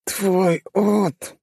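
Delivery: high lisp, teeth knocked out; lisping through missing teeth. A narrator's reading. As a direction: lisp like front teeth are gone. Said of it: "прошепелявил он".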